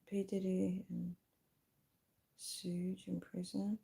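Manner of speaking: very quiet, slow, half-whispered thinking aloud; muttering to self. Real, not acted. Streamer trying to recall the second one, muttering to self. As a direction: murmur it slowly under the breath.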